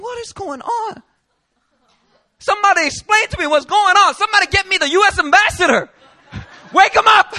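high, loud hammed-up frantic shouting; mock panic. Foreigner being fingerprinted begging for help, frantic shouting. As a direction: yell for the ambassador in mock hysteria.

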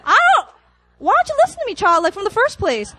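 high-pitched comic pleading scold; high, hammed-up exasperated complaint; mock exasperation. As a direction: protest at a baby in playful outrage.

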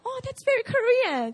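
high-pitched mock-condescending remark; condescending imitation. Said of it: "Can you say it like that".

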